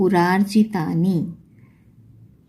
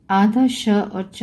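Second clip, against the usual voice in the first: आधा श और च said slowly, each sound apart; slow emphasis; slower than usual. Shown as a demonstration. Teacher naming the letter combination slowly. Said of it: "teaching the conjunct".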